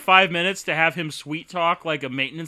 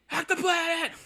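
much higher, drawn-out yelled chant; rallying cry; 13 semitones higher, slower than usual. Pretend. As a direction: rouse the crowd like a protester. Quoting the movie's rallying cry.

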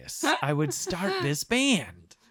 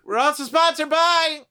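a high, loud, put-on announcer voice; mock ad read; 9 semitones higher, 4 dB louder than usual. Podcast host launching a fake gin sponsor, cheesy announcer voice. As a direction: sell it like a cheesy commercial.

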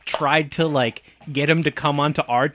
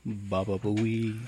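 low, slow, drawn-out mumble; Baba Booey; 6 semitones lower, 9 dB quieter, much slower than usual. Pretend. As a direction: drag it out low as a joke. Howard Stern joke line.

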